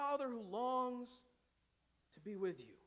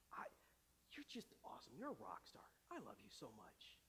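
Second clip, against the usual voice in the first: soft, lower, fading to a whisper; sweet talk to wife; 4 semitones lower, 6 dB quieter than usual. Retold or imitated. Pastor on the phone sweet-talking his wife, soft and cooing. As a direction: flatter her softly, ending in a whisper. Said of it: "I give her a call".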